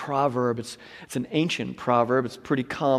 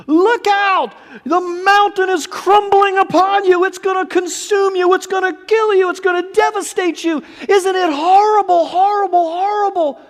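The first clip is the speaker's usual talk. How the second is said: high, loud panicked alarm; frantic high-pitched screaming; the screaming world; 9 dB louder than usual. Acted. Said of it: "the world is screaming".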